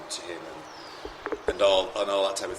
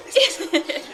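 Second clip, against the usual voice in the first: much higher and louder, laughing squeal; excited squeal; 8 dB louder than usual. Retold or imitated. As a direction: burst out with delight. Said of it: "Karen was literally going".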